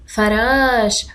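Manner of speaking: فراش said slowly with a long ش; teacher repeating فراش slowly and clearly; slow model word. Shown as a demonstration. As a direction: stretch فراش out for the class. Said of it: "أشاهد فراش".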